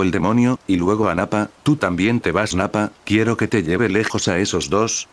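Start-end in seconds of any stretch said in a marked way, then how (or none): none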